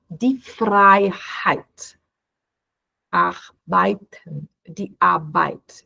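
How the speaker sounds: Freiheit and Arbeit said slowly, EI as ai; slow German EI words. Shown as a demonstration. Teacher slowly reading German EI words for students. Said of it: "é com I, som de ein".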